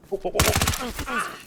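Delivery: rising panicked oh oh oh, high pitched; startled cries as danger closes in; alarmed yelps. Real, not acted. Gamer yelping as a player rushes him, alarmed.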